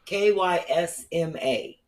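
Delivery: K Y S M A spelled out slowly, letter by letter; slow spelling. Shown as a demonstration. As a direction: spell K Y S M A slowly so they can write it. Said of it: "How do you spell it?".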